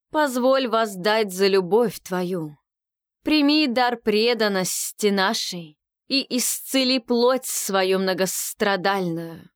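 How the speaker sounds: slow, enveloping ritual plea; hypnotic priestess prayer. Acted. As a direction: pray slowly, drawing them in.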